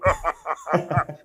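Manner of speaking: staged mocking cackle; wrestler's villain laugh. Shown as a demonstration. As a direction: laugh in character, big and theatrical. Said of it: "do the wrestling thing".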